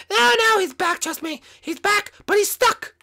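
very high squeaky urgency; insistent sidekick. Acted. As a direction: insist in a tiny, high cartoon voice.

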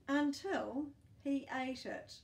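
spring the twist on them; dramatic reveal. Acted. Dramatic turn in the story.

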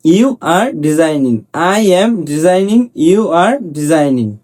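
slow, spaced-out drill sentences. Shown as a demonstration. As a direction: drill each word slowly for the class. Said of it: "teacher drills example sentences". (ধীরে ধীরে অনুশীলন বাক্য)

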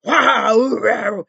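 loud playful roar; child's dragon roar. Pretend. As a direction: growl like a little dragon. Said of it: "je sais que tu sais faire le dragon". (rugissement d'enfant qui joue au dragon)

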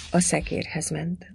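usual speaking voice

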